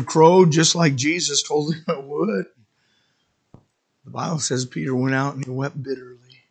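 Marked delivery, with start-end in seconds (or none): none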